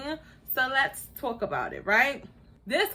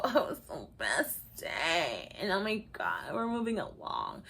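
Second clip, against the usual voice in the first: mock gushing; 5 semitones lower, 10 dB quieter than usual. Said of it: "[name] over here gushing".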